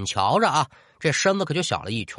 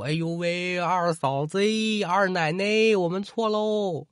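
groveling ghosts begging; 6 semitones higher than usual. Acted. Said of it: "口中是喋喋不休".

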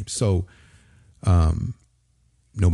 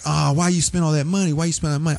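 high-pitched mock wifely complaint; imitated scolding; 8 semitones higher than usual. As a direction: mimic a wife fretting over the spending, voice up. Wife scolding him over money, retold.

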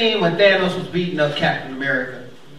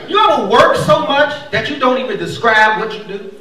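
normal talking voice

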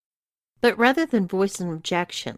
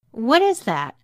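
higher, louder startled question; panicked; 5 semitones higher, 5 dB louder than usual. Acted. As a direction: ask with a touch of panic. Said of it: "asked a panicked Brittany".

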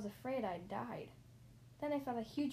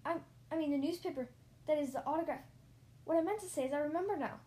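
high, hurried backtracking; raised, rattled voice speeding up; flustered; 5 semitones higher, 4 dB louder, faster than usual. A narrator's reading. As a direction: stumble and correct yourself nervously. Flustered correcting himself after slip.

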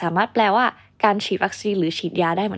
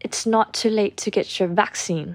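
British accent; much slower than usual. Shown as a demonstration. Teacher contrasting it with the American version, British accent. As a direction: model sentence in a British accent. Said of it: "แบบ British เนี่ยจะเป็น".

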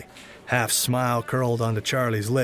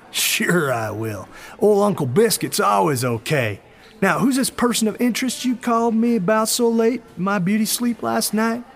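raised, loud showman manager; a loud hustler talking big; brash manager voice; 8 semitones higher, 5 dB louder than usual. Acted. Charlie Biscuit speaks, voiced character.

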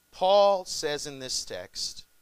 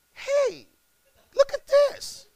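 much higher and louder, calling out excitedly; 6 dB louder, much slower than usual. Retold or imitated. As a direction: call a friend over to see something. Pastor calling out in Walmart.

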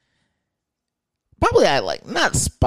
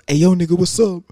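mock hype greeting; 7 semitones higher, 6 dB louder than usual. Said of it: "He goes".

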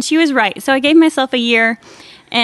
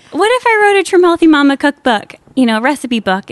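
excited pitch; 3 semitones higher, 6 dB louder than usual. Retold or imitated. Quoting her own eager idea.

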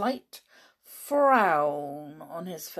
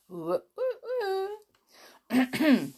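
spooky woo; 10 semitones higher, much slower than usual. Pretend. Mystery-story opener hoot.

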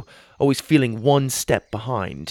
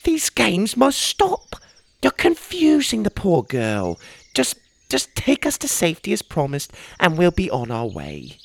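stern mother; 8 semitones higher than usual. Acted. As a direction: scold firmly like a wary mother. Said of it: "Ma shook her head".

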